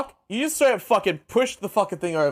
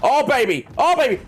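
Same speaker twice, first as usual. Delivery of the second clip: climbing higher with each cry; rising excitement; 9 semitones higher, slower than usual. Real, not acted. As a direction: shout it out, pumped up. Shark game excitement.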